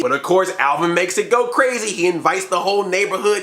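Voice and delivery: ordinary talk, nothing marked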